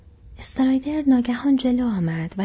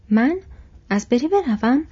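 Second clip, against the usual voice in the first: alarmed, louder and higher at the start; startled questions, then an emphatic refusal; terrified refusal; 4 semitones higher, 6 dB louder than usual. A narrator's reading. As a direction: balk at the very idea, aghast.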